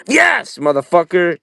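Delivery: a high, loud burst of triumph; hyped-up yell. Real, not acted. Streamer yells at the air hockey game after scoring, excited. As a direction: yell it like you just scored the winning goal. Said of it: "this game is actually getting me kinda hyped".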